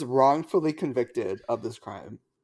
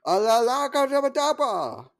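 high, slow, hammed-up wizard incantation; spell-casting voice; 12 semitones higher, 4 dB louder, slower than usual. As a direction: chant it like a cartoon wizard. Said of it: "that's how he says it".

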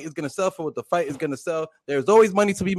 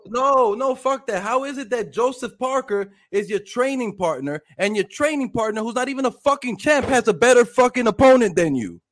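heated rant; 6 semitones higher than usual. Real, not acted. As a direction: rant it, incredulous and angry. Heated rant about opponents.

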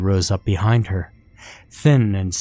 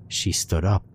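ordinary delivery

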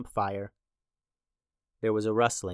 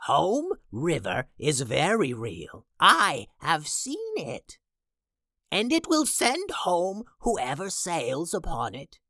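soothsayer voice; 5 semitones higher than usual. Acted. Soothsayer character voice.

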